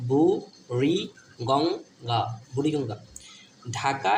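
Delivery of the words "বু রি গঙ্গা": বু, রি, গঙ্গা split by clear pauses; syllable by syllable. Shown as a demonstration. Teacher repeating a river's name for pupils, syllable by syllable. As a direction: slowly sound out each part of the name.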